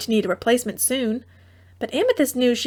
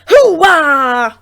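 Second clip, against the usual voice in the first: loud victory whoop; triumphant cheer; 5 semitones higher, 10 dB louder than usual. A narrator's reading. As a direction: cheer with a fist pump. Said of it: "pumped her fist".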